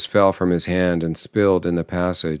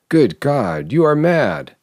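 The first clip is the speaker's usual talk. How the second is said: rising to a shocked cry; horrified outburst; aghast cry; 6 semitones higher than usual. A narrator's reading. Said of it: "he cried, aghast".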